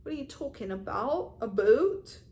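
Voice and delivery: New Jersey attempt drifting into Canadian; accidental Canadian accent. Shown as a demonstration. Vlogger botching an accent, ending up sounding Canadian. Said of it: "Now I'm sounding Canadian".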